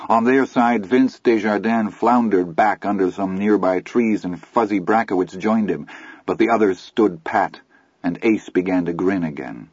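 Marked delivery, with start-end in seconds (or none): none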